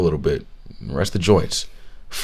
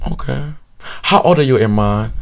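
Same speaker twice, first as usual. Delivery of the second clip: higher-pitched mock Vietnamese accent; curious question, imitated Vietnamese accent; 4 semitones higher than usual. Gym stranger asking his age, mock Vietnamese accent. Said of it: "thick, thick Vietnamese accent".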